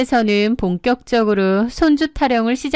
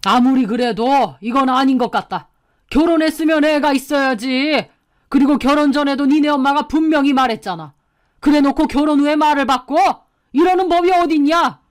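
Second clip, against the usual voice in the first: indignant scolding, climbing higher; heated, reproachful elder's voice; disapproving father-in-law; 4 semitones higher, 4 dB louder than usual. A narrator's reading. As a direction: rebuke the couple, building to outrage. Father-in-law sides with angry wife.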